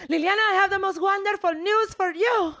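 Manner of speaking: mock an overjoyed mom; gushing mom. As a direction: mom gushing over the phone, squealing high. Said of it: "And she was like".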